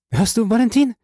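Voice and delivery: very high, excited woman's voice; thrilled, high-pitched question; Heidi's joyful call. A narrator's reading. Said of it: "rief Heidi".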